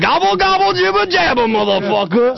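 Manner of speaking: high, loud mock turkey gobble. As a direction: squawk it out like a turkey. Turkey gobble chant, mouthguard in.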